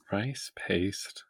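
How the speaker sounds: breathy whisper of disbelief. Real, not acted. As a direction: whisper it to yourself, baffled. S1 repeats a baffling recipe detail.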